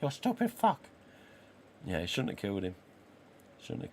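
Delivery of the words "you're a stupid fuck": much higher mock gangster voice; a hammed-up Cuban gangster accent; Tony Montana accent. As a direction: spit it out like Scarface. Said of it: "that sort of attitude and bloody accent and voice".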